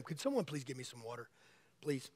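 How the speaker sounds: aside request. Real, not acted. Preacher asking a helper for water, quiet aside. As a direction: murmur it away from the mic. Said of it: "I'm losing my voice".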